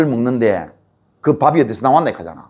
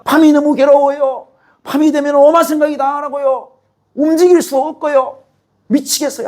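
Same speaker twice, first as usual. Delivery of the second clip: high, pleading lament to God; anguished prayer; 9 semitones higher than usual. Retold or imitated. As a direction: cry out the pain to God. Pastor voices his anguished prayer. (하나님께 괴로움을 호소하는 높은 목소리)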